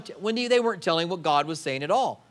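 usual speaking voice

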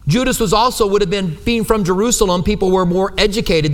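usual speaking voice

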